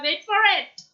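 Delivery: high, excited build-up on wait for it; excited phrase. Shown as a demonstration. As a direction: tease the listener before the reveal. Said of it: "अगर कोई exciting चीज आपको बोलनी है". (उत्साह से, ऊँची आवाज़ में)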